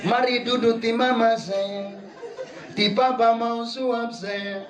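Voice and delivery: husband sweetly invites his wife to eat; sweet-talking husband. Retold or imitated. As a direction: coax her like a young lover. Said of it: "Mau makan saja apa dia bilang?".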